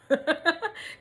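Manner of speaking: high-pitched burst of laughter; short, amused high cackle; real laugh. Real, not acted. Amused by the story.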